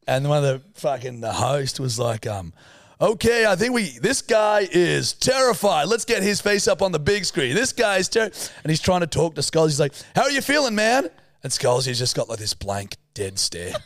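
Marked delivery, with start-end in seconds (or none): 3.0-8.2 high, loud arena announcer impression; event host. Host presents the frightened contestant to the crowd, booming announcer. 10.2-11.1 high, loud MC interview voice; event host. Retold or imitated. Host checks on the mute contestant, amped-up announcer.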